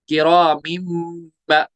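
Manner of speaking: slow किरामिन ending in a long hummed M into बा; iqlab with ghunna. Shown as a demonstration. Teacher spelling it out, किरामिन hummed before बा. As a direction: hold an M from किरामिन to बा. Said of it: "वहां पे इकलाब हुआ है".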